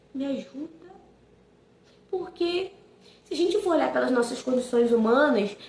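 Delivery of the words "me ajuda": a near-silent, breathless plea; desperate plea. Retold or imitated. Mother begging God for help with son's care, hushed plea. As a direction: barely voice it, as if to yourself.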